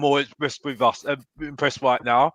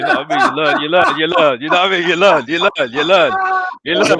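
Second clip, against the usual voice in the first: sing-song put-on voice; 3 semitones higher, 6 dB louder than usual. Playful put-on voice repeating phrase.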